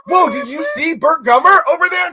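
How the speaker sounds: high, excited fan voice doing Burt Gummer; terrible Burt Gummer impression. Host to co-host after spotting Burt Gummer, high excited impression. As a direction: blurt it out like a starstruck fan. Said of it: "terrible impression".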